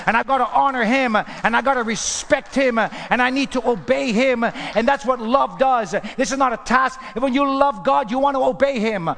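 loud, high preaching at full force; fervent preaching. Real, not acted. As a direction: drive each phrase home to the congregation.

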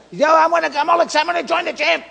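high, rushed imitation of an eager joiner; mock excitement. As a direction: gush like an over-keen new gym member. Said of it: "I'll get all excited".

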